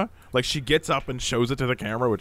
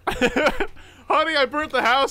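laughing; 11 semitones higher, 5 dB louder, slower than usual. Pretend. Inventing a prank title.

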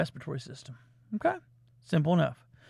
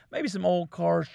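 ordinary talk

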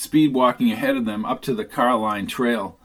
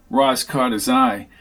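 ordinary talk

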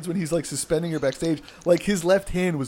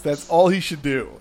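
ordinary talk, nothing marked

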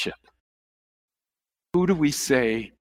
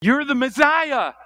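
Peter's confession; 10 semitones higher than usual. Retold or imitated. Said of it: "Peter gives the answer".

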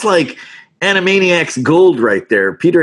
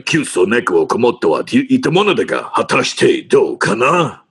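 Yakuza Japanese. Shown as a demonstration. Actor recites the hard-learned Japanese line from the show, Yakuza Japanese. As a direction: recite it as Japanese gangster slang. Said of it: "ancient Japanese, uh, Yakuza slang proverb".

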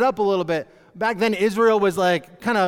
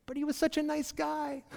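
inner thought; 7 semitones higher, 12 dB quieter than usual. Retold or imitated. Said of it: "you think to yourself".

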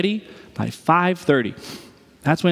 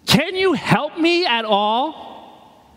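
high, slow put-on spouse voice; higher, drawn-out imitation of the spouse asking for help; mock spouse plea; 8 semitones higher, much slower than usual. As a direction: plead as the frazzled spouse. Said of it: "your spouse comes up to you and says".

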